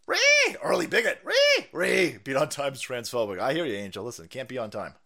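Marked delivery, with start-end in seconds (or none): 0.1-2.2 mock screech. Mocking screech.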